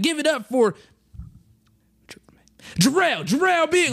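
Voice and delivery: long pause after "for", then high "Jarrell, Jarrell Big"; forgetful announcer. Retold or imitated. Host introducing a comic whose name slips, halting announcement. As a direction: imitate an MC groping for "Jarrell", pitch up. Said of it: "he paused to try to remember".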